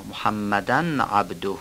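محمدن with the n sounded clearly before عبده; slow and careful, sounding the n in محمدن; clear nunation. Shown as a demonstration. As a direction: say محمدن with a distinct n, not merged.

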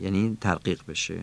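ordinary delivery

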